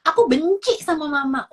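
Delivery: put-on high, loud angry-child outburst; acted tantrum shout; child yelling. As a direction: yell it like an upset child at mother. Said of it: "ketika dia teriak-teriak dan kayak".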